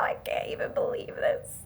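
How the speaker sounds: soft, airy whisper of disbelief; whispered mock-tearful shock. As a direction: act stunned, barely voicing it. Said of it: "I was just shocked".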